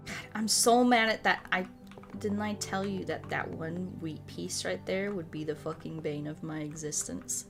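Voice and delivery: ordinary delivery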